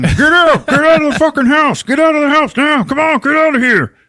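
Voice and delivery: high, loud shouting through a taped mouth; garbled, muffled yelling. Retold or imitated. Tom with duct tape over his mouth, garbled frantic yelling. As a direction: yell frantically with the words garbled. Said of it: "he just starts garbling at them".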